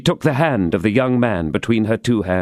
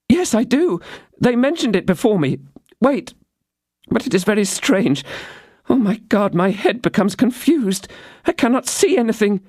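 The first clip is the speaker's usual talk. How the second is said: put-on high young woman's voice, dazed; young woman drifting into confusion; Constance, growing confused; 12 semitones higher than usual. A narrator's reading. Constance, poisoned, talks to D'Artagnan, high and dazed.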